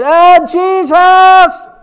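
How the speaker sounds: desperate high shout for Jesus; crying out. Acted. Blind beggar crying out to Jesus by the road, loud and high. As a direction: cry out to Jesus with everything you have.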